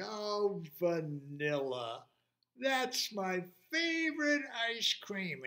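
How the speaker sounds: high, slow and delighted; delighted creature. Acted. Monster spotting his favourite ice cream, high delight. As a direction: savour the flavour in a lifted voice.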